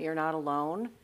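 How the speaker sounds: ordinary talk, nothing marked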